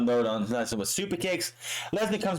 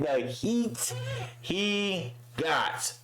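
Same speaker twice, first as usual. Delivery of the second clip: laughing; 7 semitones higher, much slower than usual. Real, not acted. Laughing at the crowd heat.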